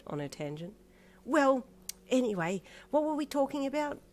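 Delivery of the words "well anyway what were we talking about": high, loud gossipy chatter; high, loud dithery gossip; rambling chatty old lady. Acted. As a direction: ramble on about her bunion. Missus Others again.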